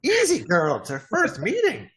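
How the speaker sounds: high, teasing little voice; high-pitched gnome banter. Acted. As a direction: playfully fend her off in a high gnome voice. Gnome teases an admirer.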